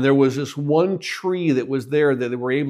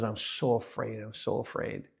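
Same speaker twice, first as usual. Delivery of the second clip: low, soft imitation of a frightened child; hushed fear; 4 semitones lower, 12 dB quieter than usual. Little boy telling his dad of the night crossing, hushed fear. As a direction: confess it quietly, lightly acting a scared boy.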